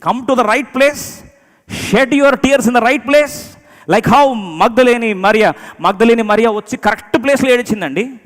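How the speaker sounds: loud, high, drawn-out preacher's cry; full-force preaching. Real, not acted. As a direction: proclaim it to the whole church at full voice.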